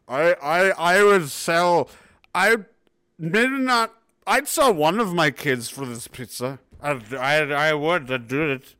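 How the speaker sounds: hammed-up drunken rambling. Drunk customer offering a kid for pizza, drunken voice. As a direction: play a drunk making a solemn vow. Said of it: "but when you're drunk".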